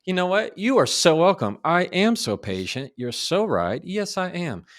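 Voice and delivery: high, loud gushing mock reply; imitating delighted recipient. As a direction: play the charmed listener, big and bright. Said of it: "They're gonna go".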